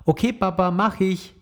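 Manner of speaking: put-on high child's call while running off. Emma calls back to Papa as she runs off, high-pitched. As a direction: call back over your shoulder, high and bright. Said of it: "ruft im Laufen".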